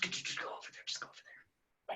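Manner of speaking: hushed, whispered shooing; playful whisper. Pretend. Guest pretending to move covid aside, playful whisper. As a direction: whisper it as if tucking something away.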